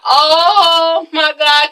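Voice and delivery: long drawn-out gasping dismay; mock shock. Viewer reacting to Derek pushed into glass, mock shocked. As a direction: stretch the words out in stagy horror. Reacting to push into trophy case.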